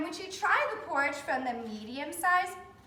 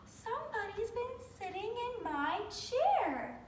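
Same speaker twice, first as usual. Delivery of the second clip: soft, gentle mother bear, a bit higher; quiet, gentle; 6 semitones higher, 6 dB quieter than usual. Acted. Mother bear gently noticing her chair, soft and quiet. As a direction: hush it gently like a kind mother. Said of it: "in a quiet, gentle voice".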